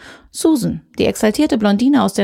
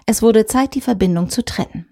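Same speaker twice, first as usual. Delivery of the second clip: plain reading voice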